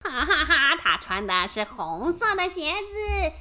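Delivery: monster laughing; gloating laugh. Acted.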